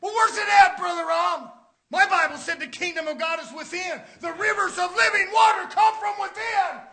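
loud, high pulpit shout; fired-up preacher, drawing out key words; full-force preaching. Real, not acted. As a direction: proclaim it to the whole congregation.

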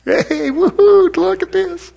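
hammed-up Pentecostal celebration; joyful whoop. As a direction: whoop it out like dancing for joy. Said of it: "I do the little Pentecostal dance".

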